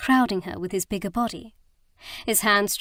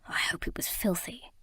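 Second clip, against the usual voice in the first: a much softer, teasing unvoiced whisper; dropped to a whisper; 7 dB quieter than usual. A narrator's reading. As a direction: drop the voice and whisper it with a tease. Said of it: "then dropped his voice".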